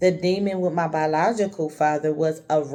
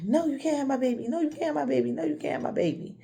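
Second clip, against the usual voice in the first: high, fast panicked pleading; terrified cry of no, you can't have my baby; retold sleep screaming; 9 semitones higher, 3 dB quieter, much faster than usual. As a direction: beg desperately in a nightmare, pitched way up. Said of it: "I was screaming".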